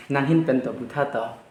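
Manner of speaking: normal talking voice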